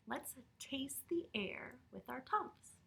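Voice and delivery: hushed storyteller whisper. Shown as a demonstration. Narrator whispering to sleepy children. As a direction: breathe the line secretly. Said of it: "do what Noah just did".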